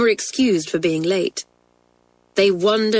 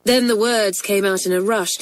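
plain reading voice

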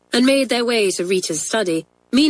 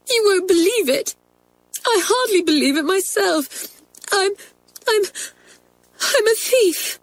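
high, halting tearful confession; sobbing; 13 semitones higher than usual. Acted. Girl confesses theft to twins, sobbing. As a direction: falter through tears, pausing before the worst. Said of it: "sobbed Kathleen".

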